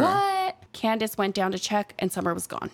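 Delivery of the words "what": high, drawn-out callback; imitated child's high-pitched reply; answering a call. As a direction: holler back up high.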